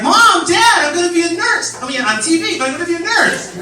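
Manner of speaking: high-pitched gushing news to parents; all excited. Retold or imitated. Excited child phoning parents about a TV nurse role, thrilled. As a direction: burst out with it, voice way up. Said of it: "I'm, like, all excited".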